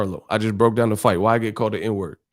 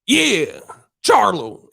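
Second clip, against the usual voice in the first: mock one-word verdict; 8 semitones higher, much slower than usual. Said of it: "Imagine if I just said".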